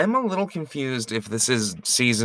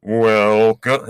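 loud announcer welcome; 7 dB louder than usual. Shown as a demonstration. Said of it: "give it a take two?".

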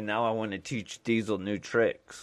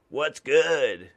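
punchy, louder "What's good?"; bold greeting; 4 semitones higher, 6 dB louder than usual. Shown as a demonstration. Mailman tries a cool greeting on his route, louder. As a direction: sell "What's good?" with a loud swagger. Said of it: "I can't".

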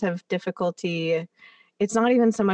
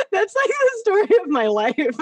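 laughing; 10 semitones higher than usual. Real, not acted. Laughing after a joke.

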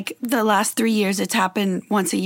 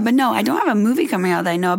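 normal talking voice

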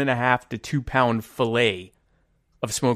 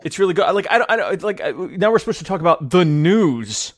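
excited; 6 semitones higher, 4 dB louder than usual. Real, not acted. Overcome by tasty salmon.

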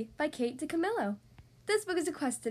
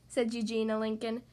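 ordinary delivery, nothing marked

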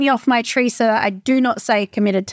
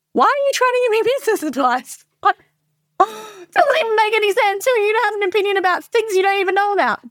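high-pitched, incredulous mock outburst; exasperated disbelief; 10 semitones higher than usual. Inner voice to people without businesses giving advice, exasperated. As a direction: act out exasperation, voice jumping way up.

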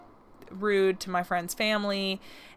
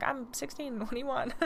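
high, soft laughing mock voice; imitating boyfriend, laughing; 5 semitones higher, 7 dB quieter than usual. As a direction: play the shrugging teen boyfriend. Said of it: "And he's like".

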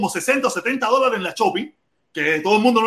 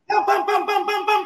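high, rapid pam pam pam; quick pam sounds; 10 semitones higher, much slower than usual. Retold or imitated. Friends hear the fast card shuffle, high rapid pam sounds. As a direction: tap out the quick deal.

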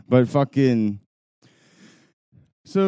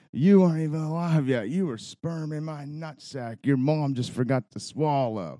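mock yelled insult; 6 semitones higher than usual. Older fan insults young fan's vintage jersey, heckling. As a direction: imitate a trash-talker hurling insults, pitched up. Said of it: "just yelling stupid shit".

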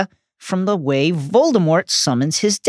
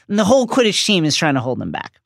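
usual speaking voice

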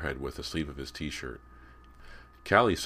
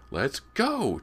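high, loud tugging plea; pestering little brother; insistent; 12 semitones higher, 6 dB louder than usual. A narrator's reading. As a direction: insist on leaving, voice pitched up. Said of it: "James insisted".

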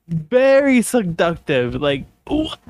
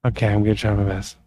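usual speaking voice

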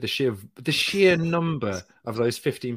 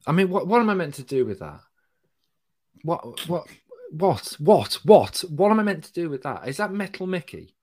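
higher-pitched, bewildered protest; baffled exasperation; 6 semitones higher, 4 dB louder than usual. Real, not acted. Co-host thrown by the robot bit, baffled and exasperated. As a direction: repeat it in rising disbelief.

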